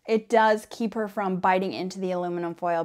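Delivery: usual speaking voice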